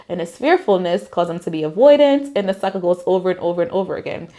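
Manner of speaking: ordinary talk, nothing marked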